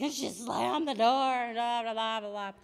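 mock the husband overplaying it; dramatic husband. Husband retelling the car ride, hammed-up dramatic. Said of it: "he's so dramatic".

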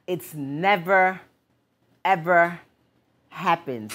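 slow, drawn-out never, ever; slow emphasis. Real, not acted. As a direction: stress never and ever slowly. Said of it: "Listen to me".

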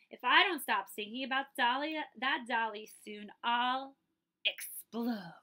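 loud, tense ranting; a cross rabbit on the edge of exploding; angry frustration. Acted. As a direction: fume until you nearly burst. Jack about to lose his temper.

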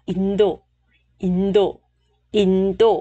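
"Indo" set low as a teaching model; gliding down. Shown as a demonstration. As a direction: glide "Indo" down into the low range. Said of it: "Luyến xuống ha".

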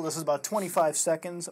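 ordinary delivery

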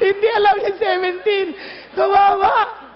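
words through real laughter; laughing. Real, not acted. Preacher laughs at the clueless pastor while telling the congregation, laughing. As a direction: laugh while pitying him.

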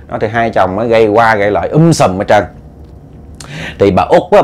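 ordinary delivery

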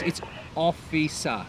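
officer said slowly and carefully, every syllable clear; correcting pronunciation. Acted. Policeman corrects a driver, slowly. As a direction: spell out officer for her. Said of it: "No, that's not right".

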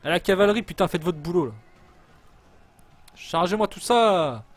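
loud, high rallying yell; shouted battle orders. Real, not acted. Player sending in the cavalry, shouting orders. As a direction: holler orders across the battlefield.

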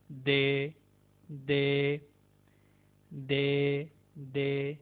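"दे" with a soft dental द. Shown as a demonstration. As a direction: say "दे" soft, not hard. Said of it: "बल्कि soft sound है". ("दे" नरम आवाज़ में)

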